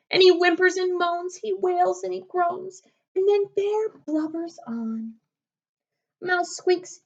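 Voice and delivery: put-on lively read-aloud for kids, slowing on the refrain; storybook reading. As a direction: read it like bedtime story time for little ones. Reads picture book to children.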